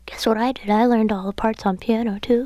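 high-pitched cartoonish imitation of a bandmate. Singer mimicking her bandmate's speaking voice, playful impression. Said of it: "your official [name], uh, impression".

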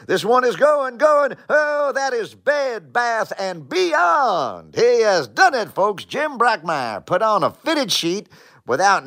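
big drawn-out home-run call; trademark call. Shown as a demonstration. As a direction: hold the call like it's leaving the park. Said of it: "have a trademark call ready".

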